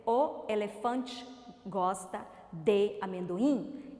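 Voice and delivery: "o elefante gosta de amendoim" slowly, pausing between words. Shown as a demonstration. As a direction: give each word of "o elefante gosta de amendoim" its own space. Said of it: "Quando eu pronuncio, por exemplo".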